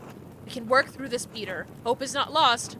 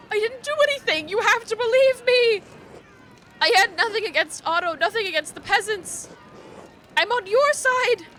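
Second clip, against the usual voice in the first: pleading; 7 semitones higher than usual. Acted. Accused man begging mob.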